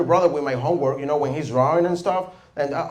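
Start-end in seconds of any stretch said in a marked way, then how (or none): none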